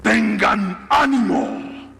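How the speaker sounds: slow, high, booming proclamation; long-drawn solemn divine call; voice of Jesus. Acted. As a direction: call it slowly across a storm. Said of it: "la Biblia dice que Jesús habla".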